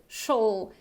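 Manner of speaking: usual speaking voice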